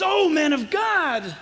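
slow, high, drawn-out "oh" of appeal; Paul breaking down. Acted. Preacher acts Paul breaking as he addresses Timothy, mild anguish. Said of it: "he begins to break".

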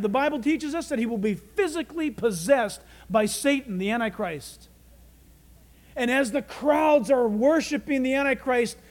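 normal talking voice

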